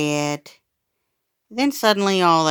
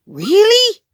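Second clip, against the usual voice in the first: gasp of alarm; 10 dB louder than usual. Acted. Brother gasps in shock.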